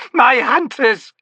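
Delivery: high, loud, drawn-out scream; terrified shriek. A narrator's reading. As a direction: tear it out in terror, slowly. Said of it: "she screamed".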